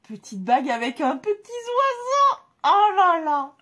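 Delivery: high, loud, almost crying with joy; loud, high cry of delight; gushing delight. Real, not acted. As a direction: swoon over the ring. Gushing over the bird ring.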